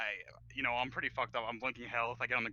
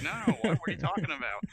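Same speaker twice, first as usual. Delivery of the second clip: flustered denial; 5 semitones higher, 6 dB louder, faster than usual. Retold or imitated. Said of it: "They're like".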